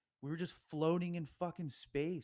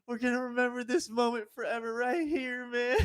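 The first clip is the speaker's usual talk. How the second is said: higher, louder, overjoyed cry; ecstatic vow; 11 semitones higher, 9 dB louder than usual. Retold or imitated. Tripper hugging his buddy, overjoyed and loud. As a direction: shout it with teary joy.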